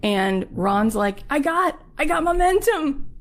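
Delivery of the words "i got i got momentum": high-pitched, acted triumph; pumped up. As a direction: imitate a hustler on a roll. Excited producer feels unstoppable.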